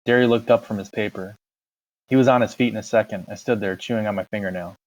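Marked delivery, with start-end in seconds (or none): none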